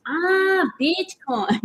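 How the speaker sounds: sudden "ah" of understanding, high start; imitating the pump attendant catching on; realizing attendant. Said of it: "then once he goes".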